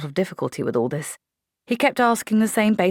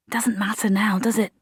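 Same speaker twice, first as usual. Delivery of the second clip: whisper; 5 semitones higher than usual. Acted. Trapped soldiers, Polly resigned.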